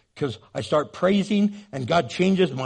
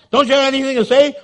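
higher, louder, fast exasperated demand; nagging wife; 8 semitones higher, 7 dB louder, much faster than usual. Retold or imitated. Elizabeth nagging Zechariah for encouragement. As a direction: push him hard for an answer.

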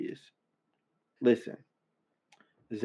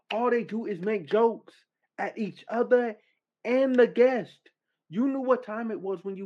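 rising rant; 8 semitones higher than usual. Real, not acted. Ranting at a guest.

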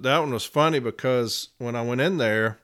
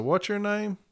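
pointed challenge; 9 semitones higher than usual. Retold or imitated. He challenges the manager.

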